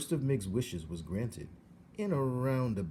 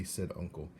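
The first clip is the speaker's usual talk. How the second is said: ordinary delivery, nothing marked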